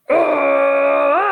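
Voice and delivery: a sudden loud cry of pain; gorilla yell of pain. Acted. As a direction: yelp as if your nose was bitten. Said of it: "and the gorilla yelled".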